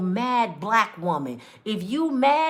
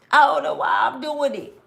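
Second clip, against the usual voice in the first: a louder, lost cry of confusion; anguished shout; 2 semitones higher, 4 dB louder than usual. Retold or imitated. Cheating husband shouting his confusion to his wife. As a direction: blurt it out like a man who can't stop himself.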